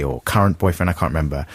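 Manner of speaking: ordinary talk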